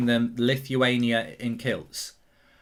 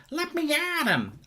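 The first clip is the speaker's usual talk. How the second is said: very high, squeaky cartoon puppy; pinched, sky-high cartoon battle cry; Scrappy-Doo; 13 semitones higher, 3 dB quieter than usual. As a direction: squeal it like a feisty little cartoon dog. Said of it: "they'll be Scrappy-Doo".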